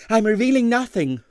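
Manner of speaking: high, mock-mysterious young voice; secretive young man. Stanley refusing to tell the boss, high and secretive. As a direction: keep your secret with pride.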